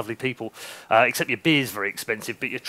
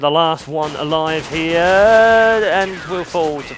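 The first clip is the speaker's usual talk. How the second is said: brisk caster call, a bit higher and louder; play-by-play; 4 semitones higher, 5 dB louder than usual. Acted. Caster calls the round for viewers, lively play-by-play. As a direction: call the action as it happens.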